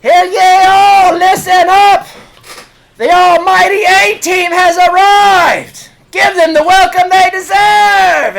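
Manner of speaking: high, loud, slow proclamation; herald shout. Acted. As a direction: announce the heroes to the whole tavern. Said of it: "and she goes".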